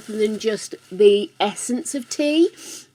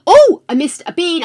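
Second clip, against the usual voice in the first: usual speaking voice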